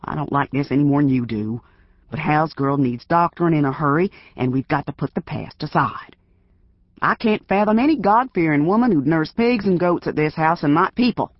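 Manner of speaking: put-on gruff old self. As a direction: threaten through the door, building to a yell. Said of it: "sounding more like his old self".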